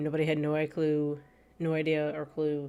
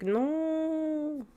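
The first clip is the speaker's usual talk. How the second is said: grandfather's no; 11 semitones higher than usual. Retold or imitated. Grandfather scolding in retold story.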